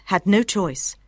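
usual speaking voice

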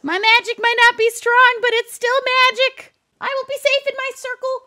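high, loud declaration; defiant cry. Real, not acted. Player facing demon from magic circle, defiant cry.

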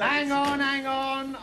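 high, drawn-out call to wait; calling a halt. Acted. Patient stopping the orderlies leaving, high drawn-out call. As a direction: stretch each "on" on a high note.

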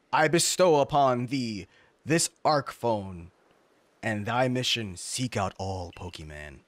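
slow, grand divine decree, sinking low at the end; solemn godly proclamation. Acted. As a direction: intone it like a god bestowing a mission. Reads a godlike message aloud.

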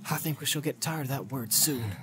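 hushed wry hobbit; lightly voiced dry Sam. Sam retorts.

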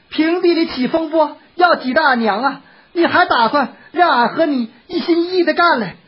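high, indignant maid's voice; furious scolding. A narrator's reading. Maid rebuking the master for bullying her mistress, furious. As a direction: tell him off in high-pitched outrage. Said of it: "勃然大怒".

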